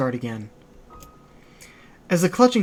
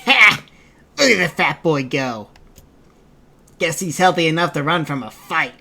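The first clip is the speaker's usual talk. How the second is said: old woman mocking; 6 dB louder than usual. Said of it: "barked out a single laugh".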